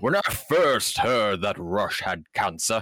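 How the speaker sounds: hammed-up corporate spokesman voice; mock professional statement. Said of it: "Yes, professional".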